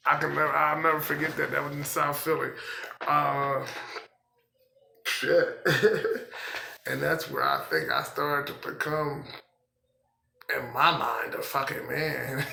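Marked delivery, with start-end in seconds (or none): 5.7-6.8 short higher-pitched chuckle; laugh. Real, not acted. Man looking back on selling drugs, short laugh. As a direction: break into a quick laugh. Laughs after swearing at memory.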